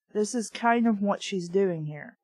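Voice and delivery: ordinary delivery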